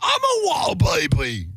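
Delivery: put-on very high, drawn-out comic accent; unplaceable British-Australian accent. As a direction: squeal slowly in a bad British-Australian accent. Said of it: "would you say that that accent is?".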